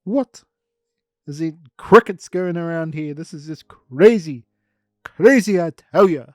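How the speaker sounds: hey hey hey, stop him taking over; indignant shouting. Acted. Ernie shouts at the one taking his organ, high loud protest.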